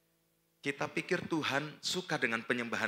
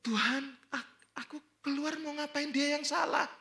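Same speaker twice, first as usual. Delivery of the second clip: high-pitched whiny objection; protesting. Retold or imitated. Stubborn worshipper arguing with God about going out, protesting. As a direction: argue back in a much higher voice.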